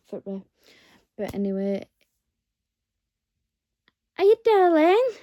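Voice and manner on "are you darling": very high, loud cooing to the cat. Real, not acted. To her cat Jenny, high cooing baby talk.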